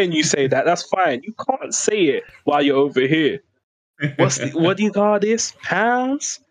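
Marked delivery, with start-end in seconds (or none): 4.2-6.4 put-on high-pitched, baffled American tourist accent; exaggerated Southern-sounding American voice. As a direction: play a confused American with a hammed-up accent. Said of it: "that's my default American accent".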